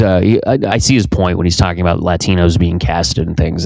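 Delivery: ordinary talk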